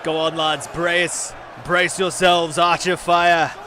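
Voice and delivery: put-on loud, high battle cry in a northern accent; rally the lads with a northern-accented shout; northern English accent. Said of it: "Rate my northern accent in chat".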